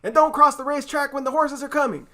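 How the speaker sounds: high, loud cry. Acted. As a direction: cry out the warning. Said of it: "cried Missus Zuckerman".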